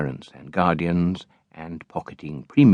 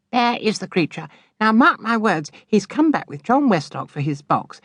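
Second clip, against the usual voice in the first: put-on high, knowing, scornful girl's voice; spiteful sister. A narrator's reading. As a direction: sneer at the creature. Charity predicts Pinch.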